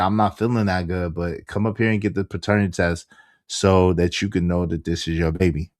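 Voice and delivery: low-pitched, lightly mimicked woman; imitating Shorty. Shorty urging him to come up, low-pitched imitation. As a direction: keep her voice down low as you quote her. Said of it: "this how she talks".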